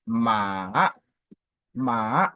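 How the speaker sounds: MA drawn out slowly, dipping down then rising; dipping third tone. Shown as a demonstration. Teacher showing the troisième ton, long MA falling then rising. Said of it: "le ton descendant, ascendant".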